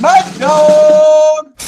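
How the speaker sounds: high, loud shout of the nickname; hype shout. Acted. Mock Trump threatening rivals with a loud hype shout. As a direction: yell it like a ring announcer.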